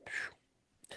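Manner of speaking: breathy whispered sigh of relief; relieved whispered phew. Retold or imitated. Soldier relieved after the chase, whispered phew. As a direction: let out a quiet whistle of relief. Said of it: "And I'm like".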